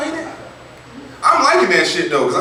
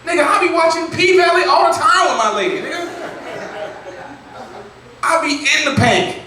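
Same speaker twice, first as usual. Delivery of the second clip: indignant shout; 12 semitones higher than usual. Retold or imitated. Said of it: "And I'm like".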